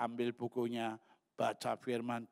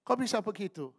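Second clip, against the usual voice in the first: high, louder incredulous question; acted surprised question; 8 semitones higher, 5 dB louder, faster than usual. Preacher asking the donor how that happened, high surprised question. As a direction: ask it high, taken aback.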